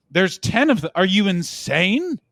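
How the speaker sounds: loud, high mock disbelief, slowing at the end; mock incredulous. Americans disputing how many Spanish speakers exist, mock outraged disbelief. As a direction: exclaim it as if they are crazy.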